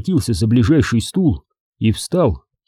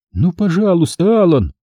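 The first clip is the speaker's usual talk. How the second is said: a higher, pleading please; begging; 7 semitones higher than usual. A narrator's reading. Pat begging Alan to speak, pleading. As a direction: implore him gently but urgently. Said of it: "взмолилась Пэт".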